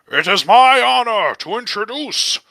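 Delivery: loud, high, drawn-out proclamation; booming announcer. Acted. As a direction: boom it out to the whole square as a grand introduction. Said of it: "in a voice that then booms".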